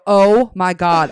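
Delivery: loud, drawn-out retold cry of shock. After the glass smacks the girl, loud shocked cry. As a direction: exclaim it slowly and loudly, acting out alarm.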